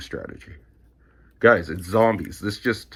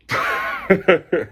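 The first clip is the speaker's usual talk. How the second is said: high squeaky laugh; 12 semitones higher, 6 dB louder than usual. Real, not acted. Laughing at the dog.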